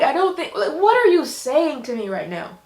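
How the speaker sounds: ordinary delivery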